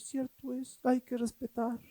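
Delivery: soft sing-song mocking of agreeing; mock meek agreement. Preacher mocking churchgoers who just agree. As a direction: parrot the crowd softly and sarcastically.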